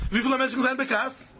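ordinary delivery

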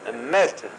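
lightly imitated exclamation, rising at the end; John Mevorach. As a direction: repeat the mimicked call, a touch emphatic. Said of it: "like, John Mevorach".

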